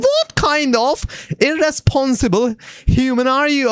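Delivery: high-pitched incredulous question; mock outrage. Gamer reacting to a mum handing over her child, mock outraged.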